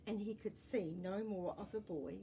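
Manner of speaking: ordinary delivery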